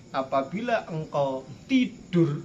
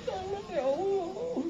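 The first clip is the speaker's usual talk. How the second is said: fake crying; 12 semitones higher, 7 dB quieter than usual. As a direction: pretend to wail for God's help. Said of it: "pura-pura nangis".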